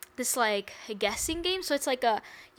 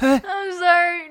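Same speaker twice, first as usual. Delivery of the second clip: laugh; 8 semitones higher, 10 dB louder than usual. Real, not acted. Laughing apology after joke.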